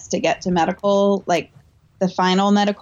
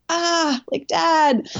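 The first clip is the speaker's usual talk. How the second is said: plaintive call; 9 semitones higher, much slower than usual. Retold or imitated. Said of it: "I'm just like".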